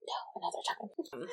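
hushed, whispered self-quote; breathy-voiceless mock secret; whispered aside. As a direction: whisper it like a private refusal. Quoting own dismissal of an idea.

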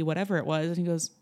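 ordinary talk

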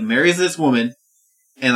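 normal talking voice

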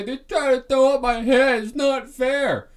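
high, loud mocking whine; mock a tattling kid running to mom; sneering crybaby impression of a star player complaining; whiny crybaby. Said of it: "go back to his mama and his manager and say".

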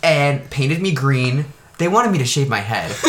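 usual speaking voice

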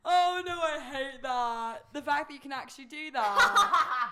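high squealing shock; shrieking disbelief. Real, not acted. Shocked by friend's impression.

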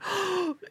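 high, soft gasp; adoring gasp. Acted. Owner seeing his precious cat, adoring gasp. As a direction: gasp as if the heart melts.

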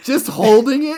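high, loud disbelieving question; incredulous. Real, not acted.

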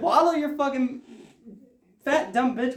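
reenacted angry outburst; retold angry insult. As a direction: act out switching into a rant. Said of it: "And I just fucking switched".

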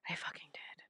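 unvoiced guilty whisper; sheepish whispered admission. Real, not acted. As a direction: confess it under your breath. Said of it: "admits under breath".

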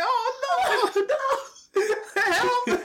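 very high, laughing cry for help; mock panic. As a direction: squeal for rescue, hammed-up and giggly.

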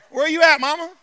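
ordinary talk, nothing marked

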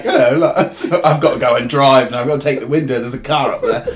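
playful put-on British accent; exaggerated British voice. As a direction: chatter to yourself as a mock Brit. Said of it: "start talking in a British accent".

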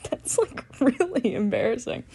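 breathy laughing through words; laughing it off, embarrassed. Real, not acted. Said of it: "That's so embarrassing for me".

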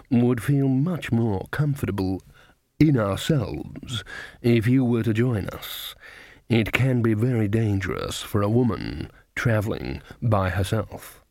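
slow, measured card-sharper voice; smooth card sharper. A narrator's reading. Card sharper invites Granny to the table, smooth and slow. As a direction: draw out a courteous invitation, stretching the words.

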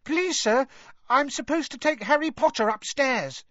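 very high, eager little voice; squeaky schoolboy. A narrator's reading. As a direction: pipe up politely to a feared teacher. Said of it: "squeaked Colin".

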